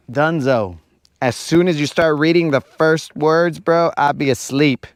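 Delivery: usual speaking voice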